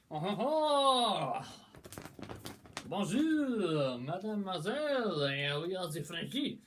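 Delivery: mock French accent. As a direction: greet her like a cartoon Frenchman with a big French accent. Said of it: "'cause the French".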